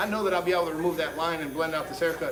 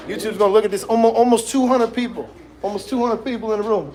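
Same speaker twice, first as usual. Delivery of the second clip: loud, exasperated call to chat; shouting over wind; 4 semitones higher, 9 dB louder than usual. Real, not acted. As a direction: raise your voice in disbelief. Complaining to chat about likes.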